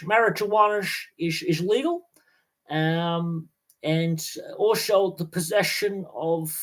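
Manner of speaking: hammed-up Dutch accent, pitched high. Podcaster doing his favourite Dutch impression for listeners, high hammed-up Dutch accent. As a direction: play the proud Dutchman showing off his country. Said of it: "I do like doing my Dutch accent".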